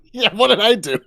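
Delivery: high laughing wounded voice; laughing mock hurt. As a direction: giggle through pretend offence. Joining the mock hurt bit.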